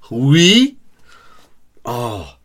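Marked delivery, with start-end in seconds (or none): 0.1-0.8 mock posh "Oui?", high and loud; poncy chef. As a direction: sneer it like a pretentious chef. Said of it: "it's so poncy".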